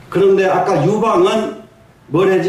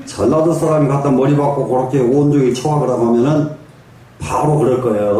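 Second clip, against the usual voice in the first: ordinary talk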